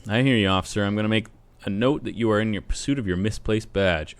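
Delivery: ordinary talk, nothing marked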